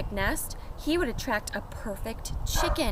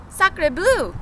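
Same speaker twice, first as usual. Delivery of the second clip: excited cry; 8 semitones higher, 7 dB louder than usual. Acted. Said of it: "cried the duck".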